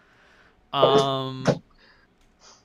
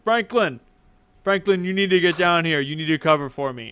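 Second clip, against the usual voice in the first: calling out; 3 semitones higher, 6 dB louder than usual. Acted. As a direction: summon a colleague urgently. Doorman calls up to Franklin.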